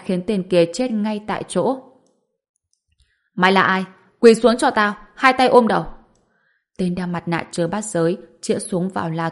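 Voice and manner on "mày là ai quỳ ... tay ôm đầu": barked order at gunpoint; angry shout. Acted. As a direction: shout it as a furious command. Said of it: "quát lên".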